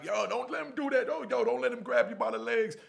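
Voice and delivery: high, loud sideline hollering; shouted coaching. Retold or imitated. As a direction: yell urgent advice to a fighter. Egging on a friend mid-fight.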